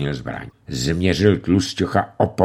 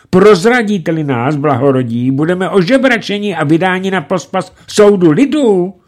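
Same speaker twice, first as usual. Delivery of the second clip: higher, louder begging; pleading; 10 semitones higher, 5 dB louder than usual. A narrator's reading. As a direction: beg him to understand. Old bandit begs. (prosebně)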